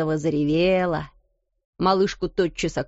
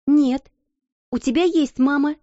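bright, loud cheerful protest; cheerful objection; 7 semitones higher, 4 dB louder than usual. A narrator's reading. Girl cheerfully comforting a crying child. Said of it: "весело возразила Сара".

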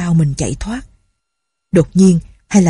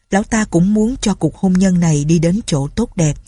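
plain reading voice

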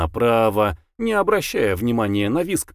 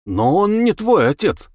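indignant, higher retort; 8 semitones higher than usual. Acted. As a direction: protest the lie, half amused. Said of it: "возмутился Гевин".

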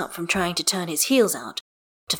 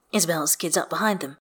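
ordinary delivery, nothing marked

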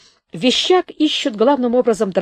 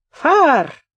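high, loud one-word order; soldier's command; 7 semitones higher, 5 dB louder than usual. A narrator's reading. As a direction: call out sharply to move them on. Soldier waves them on.

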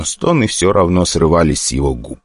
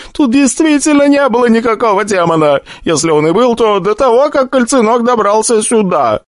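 tearful despair. Acted. The summoner reaches out to Stronger in tears, higher. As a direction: break down in tears. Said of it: "залился слезами".

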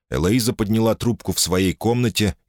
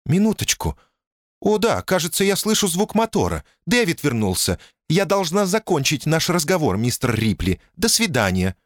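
much higher, hurried and tense; high thin voice; 10 semitones higher than usual. A narrator's reading. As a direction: pitch up, rush the goodbye. Janice hurries off phone.

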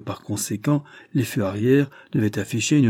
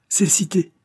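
much higher and louder, jolted by the word; startled exclamation; 10 semitones higher, 4 dB louder than usual. Acted. Drishna to blind Carados, electrified: higher startled cry. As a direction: snap to attention on that one word. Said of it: "s'exclama Drishna ... électrisé par le mot".

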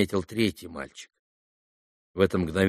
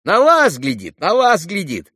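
high, loud excited echo; boys chiming in; 9 semitones higher, 8 dB louder than usual. A narrator's reading. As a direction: take up the cry like a gang of kids. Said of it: "подхватили мальчики". (мальчишки наперебой подхватывают)

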